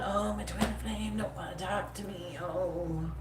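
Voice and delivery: slow and soft, the same lament in her own voice; her own take on the mope. Retold or imitated. Reader redoes the lament as she would say it, soft and slow. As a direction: say it the way you yourself would sigh it. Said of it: "This is what I sound like if I was to say that".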